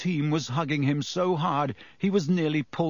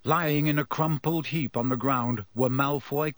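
usual speaking voice

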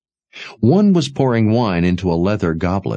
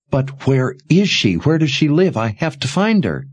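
higher, pleading; desperate; 9 semitones higher than usual. Acted. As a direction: beg him for an answer, rising panic. Jensen asks again, more desperate.

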